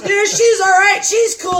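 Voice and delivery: high, loud calls of approval; crowd calling approval. Retold or imitated. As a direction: shout it like guys cheering her on. Crowd of guys approving her.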